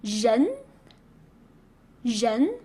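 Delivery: 人 as "zhen", rising like a question; standard second tone. Shown as a demonstration. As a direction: start 人 with a French J and glide upward. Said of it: "R E N is".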